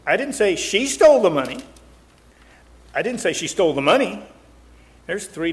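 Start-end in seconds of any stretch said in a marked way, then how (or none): 0.1-1.6 she punched loud and high; emphatic she in mid-sentence, falling after; stress on she. Shown as a demonstration. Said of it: "the emphasis you place on it". 2.9-4.2 money pushed louder at the end; emphatic money, as if something else was stolen; stress on money. Shown as a demonstration. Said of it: "the emphasis you place on it".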